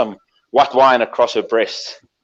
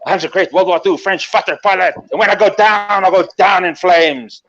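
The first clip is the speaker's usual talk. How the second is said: boastful French hero, rising to a punchline; French fighter pilot; 4 semitones higher than usual. Acted. Pilot boasting as the flames go up, grandly French. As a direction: build higher, stretching the last phrase. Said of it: "He says".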